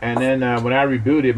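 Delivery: usual speaking voice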